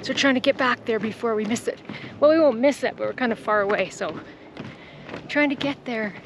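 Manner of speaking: ordinary talk, nothing marked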